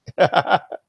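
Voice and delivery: short high giggle; real laugh. Real, not acted. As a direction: break into a quick, high-pitched chuckle. Amused by Julie's snack comment.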